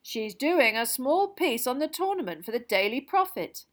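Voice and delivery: a jolly official welcoming at full volume; booming Bagman. Acted. Jolly official loudly welcoming Harry into the room, booming and hearty.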